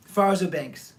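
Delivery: plain reading voice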